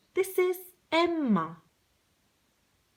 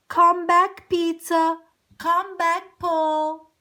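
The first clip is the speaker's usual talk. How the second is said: loud call, a touch higher; 4 semitones higher, 8 dB louder than usual. Acted. Emma calling her birds home, loud and eager. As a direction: call the birds back across the garden.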